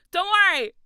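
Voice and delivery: high, loud laughing; laughing reassurance. Real, not acted. Streamer laughing while reassuring chat. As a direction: giggle through it.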